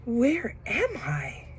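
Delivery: soft slow breathy whisper; dazed whisper. A narrator's reading. Bear just woken, confused soft whisper. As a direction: whisper it, still half asleep. Baloo waking in a daze.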